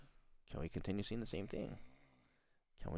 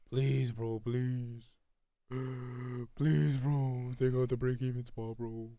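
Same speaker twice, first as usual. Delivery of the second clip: pleading; 6 semitones higher, 6 dB louder, slower than usual. Real, not acted. Begging the chart to move.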